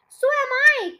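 high alarmed cry; cried. A narrator's reading. Wife crying out that she shrinks too, high alarmed cry. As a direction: wail it out in fright. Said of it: "cried Missus Twit".

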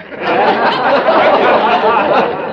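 loud, tickled laugh; hearty goofy laugh; delighted laugh. Acted. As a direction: chuckle with pleasure at the speech. Mark laughs reading the speech.

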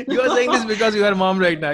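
real laughter while teasing the guest; laughing tease. Real, not acted. Host teasing the guest about being a mom, laughing.

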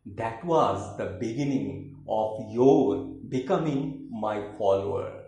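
emphatic declaimed verse with high phrase openings; theatrical poem reading with swooping intonation; poetry recitation. Acted. Recites third poem, In Return.

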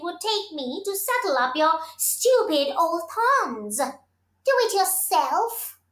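haughty, dismissive sneer; scornful. A narrator's reading. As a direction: toss it off with contempt. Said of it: "tossed her head and replied".

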